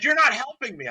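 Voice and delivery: high, loud mock complaint; exasperated client. Client complaining to trainer painting a wall, exasperated imitation. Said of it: "And she's like".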